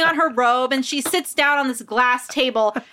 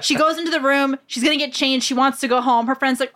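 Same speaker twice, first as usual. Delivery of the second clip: ordinary talk, nothing marked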